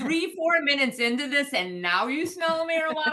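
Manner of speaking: high, indignant retold challenge; incredulous retort. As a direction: throw the accusation back in mock disbelief. Retells arguing with officer over marijuana.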